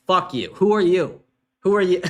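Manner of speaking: ordinary delivery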